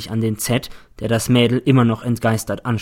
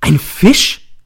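loud, higher startled cry; shouting; 7 semitones higher, 8 dB louder than usual. A narrator's reading. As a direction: jump up and shout it out. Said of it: "Ich springe auf und schreie".

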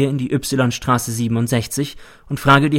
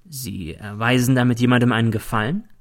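plain reading voice

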